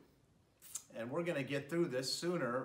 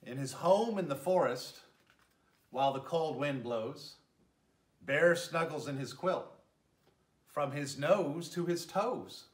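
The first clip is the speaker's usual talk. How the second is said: gentle, lilting read-aloud voice; storybook narration; 4 dB louder than usual. A narrator's reading. Mayor reading a bedtime story to children, lilting narration. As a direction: tell it like a bedtime story for little ones. Reads picture book to children.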